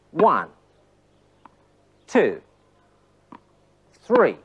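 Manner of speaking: ONE, TWO, THREE punched out singly with long gaps; emphatic counting. Shown as a demonstration. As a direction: call out each number on the strike. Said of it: "Emphasis on the moments".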